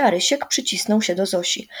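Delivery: usual speaking voice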